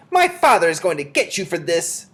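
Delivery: high, loud bratty threat; shrieking. A narrator's reading. Pushed boy threatens with his father, shrieking.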